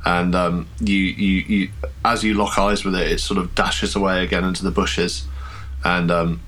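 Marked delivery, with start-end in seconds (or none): none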